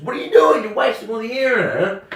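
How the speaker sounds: very high-pitched mock telling-off; high, hammed-up female scolding; scolding woman. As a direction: imitate a woman exasperated at your clumsiness. Sarah scolds his balloon blowing.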